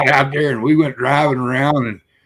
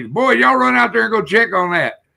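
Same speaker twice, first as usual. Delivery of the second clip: high, urgent retold command; imitated father's order; 9 semitones higher than usual. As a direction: act out a dad sending his boys running. Retelling father ordering boys out.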